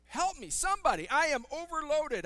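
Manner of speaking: loud, high cry for help; desperate plea. Acted. Overwhelmed person calling for help, desperate plea.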